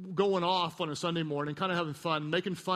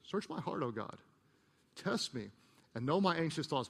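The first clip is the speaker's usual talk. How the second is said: quiet prayer; 5 semitones lower, 7 dB quieter than usual. Acted. Reading the dangerous prayer softly.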